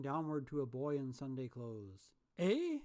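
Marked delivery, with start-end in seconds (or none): 2.4-2.8 put-on high, loud puzzled boy; a boy surprised; wondering boy. A narrator's reading. Boy in the street answering Scrooge, puzzled.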